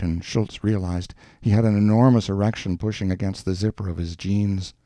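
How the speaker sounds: usual speaking voice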